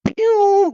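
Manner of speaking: high whistling pew of a rocket taking off; high rocket zip; rocket sound. Retold or imitated. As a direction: shoot it up like a rocket launching. Price shoots up like rocket.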